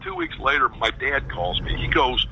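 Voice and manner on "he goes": normal talking voice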